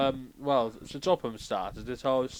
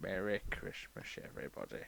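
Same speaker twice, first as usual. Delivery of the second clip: reluctant greeting, second try; 5 semitones lower, 12 dB quieter than usual. Pretend. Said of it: "I can try again if you like".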